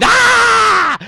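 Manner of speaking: put-on piercing high shout of a banging toddler; sudden shrill "Ah!" at full volume; toddler's yell. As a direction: scream it like a toddler hitting a pot. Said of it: "a toddler banging a pot with a spoon and going".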